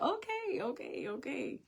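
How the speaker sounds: put-on calm the crying child down; soothing mother.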